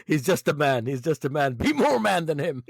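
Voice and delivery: high, laughing coach voice; laughing Apollo impression. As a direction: quote it while cracking up.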